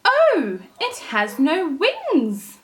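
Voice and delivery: high, loud scornful oh; mayfly mother sneering at Thumbelina; disdainful mother. Acted. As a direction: look down your nose at the little girl. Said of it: "said the mother".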